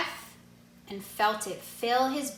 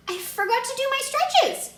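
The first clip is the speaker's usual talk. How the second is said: put-on much higher, bright child voice; eager child excuse; 4 dB louder than usual. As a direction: blurt it out as a sudden excuse. Jabari inventing an excuse.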